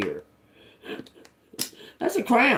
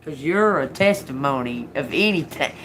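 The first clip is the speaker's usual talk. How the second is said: usual speaking voice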